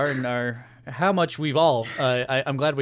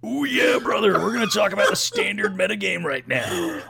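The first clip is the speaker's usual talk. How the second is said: Macho Man Randy Savage; 5 semitones higher than usual. Acted. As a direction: ham up a wrestling promo. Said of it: "actual Macho Man Randy Savage impressions".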